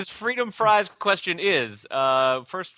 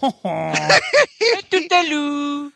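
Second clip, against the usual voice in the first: mock French; 7 semitones higher, 6 dB louder, slower than usual. Joking fake French banter.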